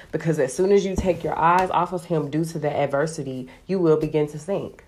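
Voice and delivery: ordinary talk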